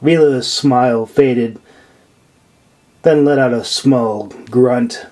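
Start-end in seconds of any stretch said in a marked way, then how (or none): none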